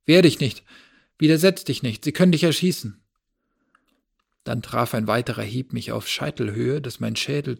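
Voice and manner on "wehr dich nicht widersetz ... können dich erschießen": much louder, higher urgent warning; frantic loud shout from the kitchen; desperate shout. A narrator's reading. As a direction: scream the warning to save him. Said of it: "wie Fabien aus der Küche rief".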